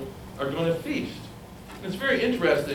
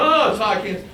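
mild mock complaint; 8 semitones higher, 5 dB louder than usual. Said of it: "you're screaming".

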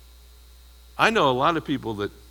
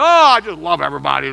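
mock gushing; 2 semitones higher, 8 dB louder, faster than usual. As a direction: parody a syrupy do-gooder. Mocking sentimental love talk.

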